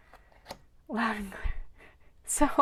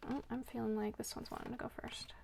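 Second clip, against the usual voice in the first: very soft, half-whispered aside; barely voiced, as if thinking aloud; hushed aside; 12 dB quieter, faster than usual. Real, not acted. Reader to herself about which one goes first, hushed aside. Murmured aside while choosing a card.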